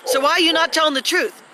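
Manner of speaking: high, pushing demand; heated confrontation. Real, not acted. Woman presses her boyfriend to tell the truth, high and heated. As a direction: confront him, pitch up.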